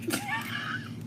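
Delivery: short high soft giggle; squeaky little laugh; quick high quiet giggle. Real, not acted. Laughs after asking viewers.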